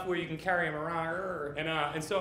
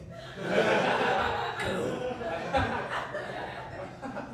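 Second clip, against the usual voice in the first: a long real laugh at his own joke; laughter; 4 semitones higher than usual. Real, not acted. Comedian cracking up at his own crosswalk story, laughing. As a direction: breaks up laughing into the mic.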